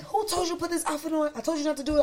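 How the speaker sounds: high-pitched mock scolding scream; retold scream. Designer scolding model over the outfit, retold scream. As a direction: imitate him screaming, pitch high throughout. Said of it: "He, like, literally screamed".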